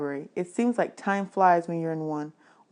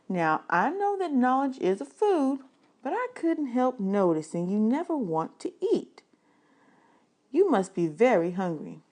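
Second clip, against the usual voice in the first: a lightly higher lady's voice; librarian voice; 6 semitones higher than usual. Acted. Librarian handing the hungry boy food, lightly higher voice.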